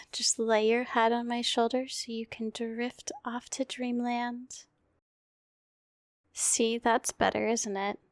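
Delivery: hushed, tender bedtime voice; gentle murmured ASMR comfort. Acted. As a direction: lull a sleepy partner off to sleep. Whole comfort roleplay.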